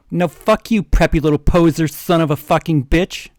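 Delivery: loud disgusted swearing, fast in the middle. Acted. Sidekick curses the janitor in open disgust. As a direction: spit the insults out in disgust. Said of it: "under his breast in a disgusted way".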